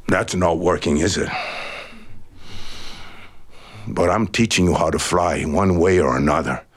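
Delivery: patient teacher who won't give up; calm eagle. A narrator's reading. Eagle telling the chicken it will learn to fly.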